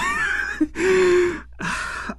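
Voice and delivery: a high squeaky burst of laughter; incredulous laugh. Real, not acted. Laughing at the show's bad writing.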